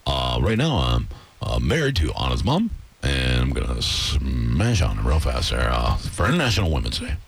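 stepdad voice. Pretend. Stepdad Roger introducing himself like a radio host, low.

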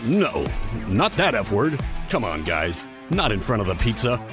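deep voice scolding the audience; low comic aside. Acted.

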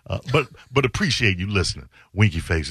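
sarcastic. Retold or imitated. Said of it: "I added, uh, sarcastically".

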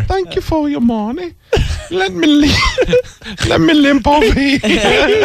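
very high, put-on English lady voice, slow at the end; prim English lady squeaking up high; Heather Mills impression. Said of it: "That's how she talks".

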